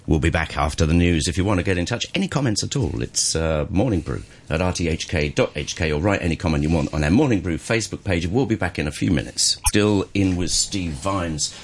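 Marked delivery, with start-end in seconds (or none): none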